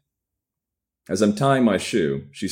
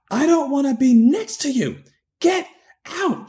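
much higher, louder shrieking; 4 dB louder than usual. A narrator's reading. Woman throwing him out at night, high shrieking. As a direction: screech at him in a fury. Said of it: "she shrieks".

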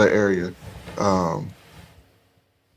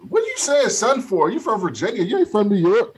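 teasing disbelief; 7 semitones higher than usual. Real, not acted. Teasing friend about his slang.

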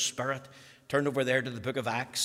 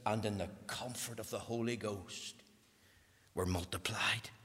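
softer and lower into a whisper; fading to a whisper; 8 semitones lower, 9 dB quieter than usual. Real, not acted. Preacher reading scripture to the congregation, fading to a whisper. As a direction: let the verse die away to a whisper.

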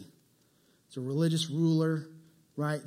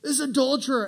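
smug; 7 semitones higher, 4 dB louder than usual. Retold or imitated. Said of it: "And he's like".

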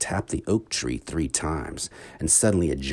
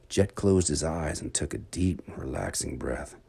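ordinary delivery, nothing marked

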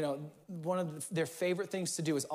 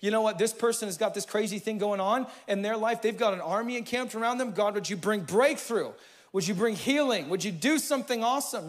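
raised, higher fervent petition; bold prayer; 5 semitones higher, 6 dB louder than usual. Shown as a demonstration. Pastor demonstrating a prayer, louder and higher. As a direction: call on God with urgency. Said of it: "I'm dialoguing with God".

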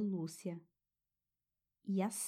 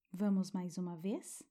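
guided meditation. Acted. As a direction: soothe a child toward sleep.

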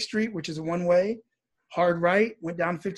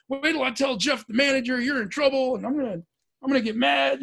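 higher-pitched, mildly indignant rehearsed complaint; retold angry threat; 6 semitones higher than usual. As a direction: play at threatening to report someone. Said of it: "I'm getting angry".

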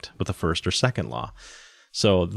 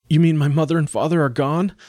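put-on high-pitched, disbelieving child's question; young boy in dismay; 8 semitones higher, 4 dB louder than usual. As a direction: ask as a boy grasping bad news. Boy learns his parents are gone.

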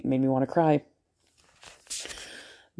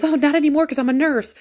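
much higher, louder flustered reply; panicked denial; 12 semitones higher, 7 dB louder than usual. Retold or imitated. Nurse quickly denying her past to the doctor, high and flustered. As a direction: blurt it out in a panic. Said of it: "she looked a little panicked".

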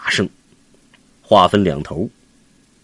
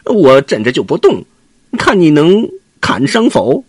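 cocky taunt; 7 semitones higher, 6 dB louder than usual. Acted. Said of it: "悟空笑着说".

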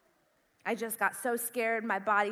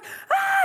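high, loud scream; panicked scream; 6 dB louder than usual. Retold or imitated. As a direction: shriek in mock terror, reenacting a fright. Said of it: "and I start screaming".

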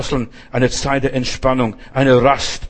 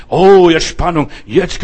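mock thrill; 3 semitones higher, 7 dB louder, slower than usual.

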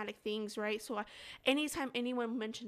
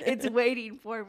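mock spooky voice; 7 dB louder than usual. Voicing the drain monster threat.

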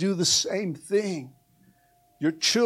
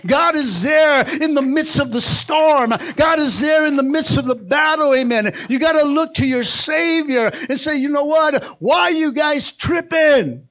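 loud, high, building sermon cry; preaching at full force; 7 semitones higher, 6 dB louder than usual. Real, not acted. Pastor urging church to keep living, full-force preaching.

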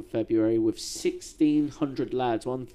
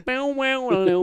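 mock Russian jingle; 13 semitones higher, 5 dB louder, slower than usual. Mimicking Russian pop scene.

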